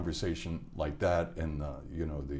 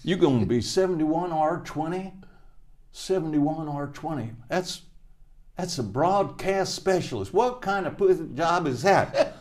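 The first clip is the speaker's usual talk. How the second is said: much higher, louder, jeering sergeant; mocking drill sergeant; 10 semitones higher, 5 dB louder than usual. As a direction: sneer like a drill sergeant, laughing. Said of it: "He said".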